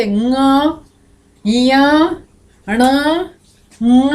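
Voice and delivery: "ङ", "इया", "ण" each drawn out separately; isolated, held nasal sounds on "ङ", "ण"; nasal letter demonstration. Shown as a demonstration. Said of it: "बोलो, आके देखो".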